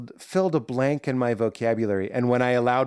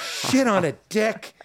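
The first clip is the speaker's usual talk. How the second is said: relishing each word, pitched up; savoring it; 8 semitones higher than usual. Shown as a demonstration. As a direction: say it like a treat. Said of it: "find the pleasure of".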